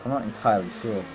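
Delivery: ordinary talk, nothing marked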